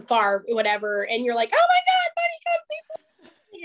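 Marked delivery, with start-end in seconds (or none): 1.5-3.6 mock thrilled. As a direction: gush as if the money just arrived. Thrilled at a five-dollar check.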